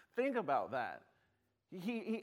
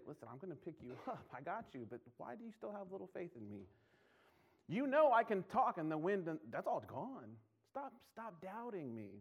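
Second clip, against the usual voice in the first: gentle Jesus; 2 semitones higher than usual. Acted. Jesus voiced softly to believer.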